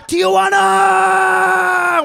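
very high, long loud shout; celebrating escape, shouting; drawn-out victory yell. Retold or imitated. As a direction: scream it out the window. Friend yelling while celebrating.

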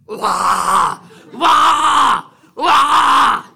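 loud high bellowing cattle call. Shown as a demonstration. Wife shows she can call the cows too. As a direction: holler it across the pasture. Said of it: "that was the voice I knew".

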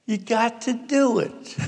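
high, pleading yell at Dad; high-pitched acted scream, begging; retold screaming. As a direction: imitate a frantic daughter demanding love from her father. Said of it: "I hear Carol screaming at my father, screaming".

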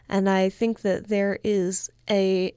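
usual speaking voice

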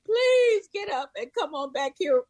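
high, pleading voice rising on the last please; begging passengers. Retold or imitated. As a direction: beg her desperately to move back. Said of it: "they were begging me".

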